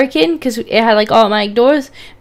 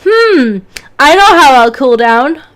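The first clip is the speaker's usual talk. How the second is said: higher, louder pretend musing; mock bright idea; 3 semitones higher, 6 dB louder than usual. Mocking the ice lolly idea to co-streamer, mock pondering. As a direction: act out a sudden silly brainwave. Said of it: "picked up an ice lolly and thought".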